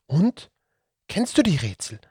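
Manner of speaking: high, loud eager question; eager child. Acted. Franziska pressing the Datzelwurm, loud and eager. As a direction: press him eagerly for an answer.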